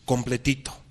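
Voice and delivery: usual speaking voice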